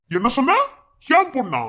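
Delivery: high, loud scornful question; enraged mocking query; angry challenge. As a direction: fling it back at her. Furious disbelief.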